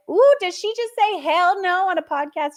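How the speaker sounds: high, loud hammed-up disbelief; scandalized listener. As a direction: play the shocked churchgoer clutching pearls. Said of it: "You're like".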